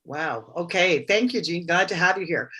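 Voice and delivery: usual speaking voice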